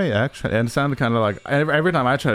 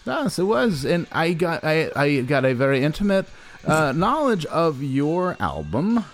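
interviewer voice; 6 semitones higher than usual. Acted. Interviewer voice, high pitch.

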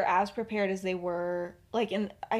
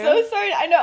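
ordinary delivery

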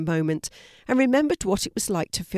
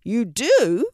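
louder, higher, eager question; excited; 4 semitones higher, 7 dB louder than usual. A narrator's reading. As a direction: ask it with a burst of excitement. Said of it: "asked Douglas with excitement".

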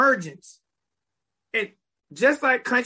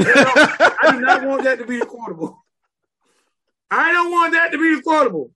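laughing protest; 5 semitones higher, 7 dB louder than usual. Real, not acted. Host laughs, protests loudly.

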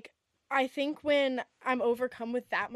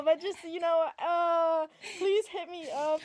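flirty plea; 5 semitones higher than usual. Acted.

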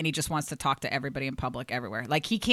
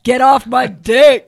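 "Get Off My Dick" slow and much louder, like a show title; announcer title read; 7 semitones higher, 15 dB louder, much slower than usual. Shown as a demonstration. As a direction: boom out "Get Off My Dick" as the show's name. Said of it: "How about something a little edgier".

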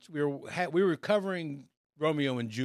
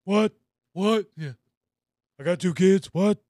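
loud, higher wrestler bark of What; Stone Cold voice; 6 semitones higher, 7 dB louder than usual. Retold or imitated. Reading a Tinder profile as a wrestler, loud and barking. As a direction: shout it like a pro wrestler promo. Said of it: "Stone Cold Steve Austin's voice".

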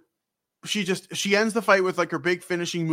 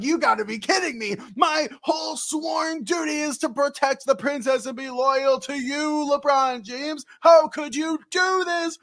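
panicked knight; 11 semitones higher than usual. Retold or imitated. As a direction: wail it like a betrayed loyal knight. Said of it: "Steiner is like".

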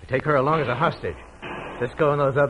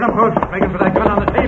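shouted urging; 6 semitones higher, 7 dB louder, faster than usual. Acted. Gunfight, urging partner on.

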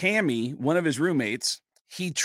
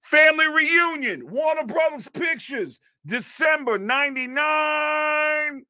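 mock shouted plug; 13 semitones higher, slower than usual. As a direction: bellow the film plug like an angry man. Angry guy plugging his film.

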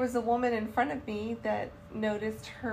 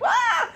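high loud laughing yelp of panic; startled yelp; 13 semitones higher, 10 dB louder than usual. Retold or imitated. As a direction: shriek in happy alarm. Retold panic at being called.

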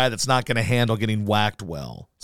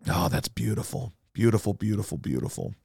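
savoring gloat; 3 semitones lower, 6 dB quieter than usual. Real, not acted. Gloating over rival's firing.

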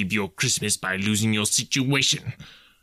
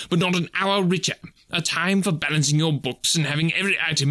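mounting, higher-pitched tirade; indignant; 5 semitones higher than usual. A narrator's reading. Scrooge railing at his nephew about Christmas, indignant. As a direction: rant with rising indignation. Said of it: "said Scrooge indignantly".

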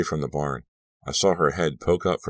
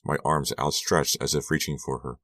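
ordinary delivery, nothing marked

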